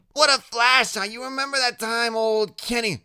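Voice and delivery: very high excited chatter; Loretta, chatty. Acted. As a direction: reminisce in a high-pitched character voice. Loretta chattering about old times.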